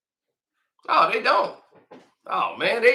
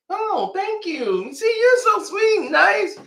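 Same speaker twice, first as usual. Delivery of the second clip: mock gushing; 10 semitones higher than usual. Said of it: "we'll give you eighty K".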